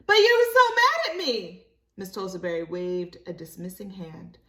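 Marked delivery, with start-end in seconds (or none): 0.1-1.7 put-on very high, loud surprised little girl; child voice. Libby reminding Miss Tulsaberry she was mad, very high. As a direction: protest it like a puzzled child.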